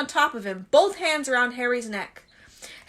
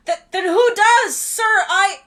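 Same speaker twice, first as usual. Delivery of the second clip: high, loud stammering worry; anxious urgency; 8 semitones higher, 8 dB louder than usual. Acted. Harry anxiously pressing Dumbledore, high and loud. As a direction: stumble over the words in alarm.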